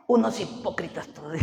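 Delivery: imitated disdain, out in full voice; mock scornful verdict. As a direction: repeat the scornful verdict aloud. Repeats wife's verdict louder.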